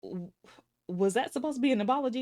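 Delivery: high, quick disbelieving question; indignant, rapid-fire comeback; incredulous. Acted. Incredulous reply to Tiffany's excuse.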